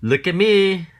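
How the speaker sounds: high, loud boastful cry; showing off. Acted. Cat brags to children, loud call. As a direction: shout for everyone to look.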